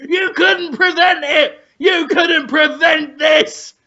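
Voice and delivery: high, wild triumphant yelling; hammed-up crazed screaming; mock frenzied shout. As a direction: shriek it like a chef gone mad. Acted Ramsay frenzy with needles.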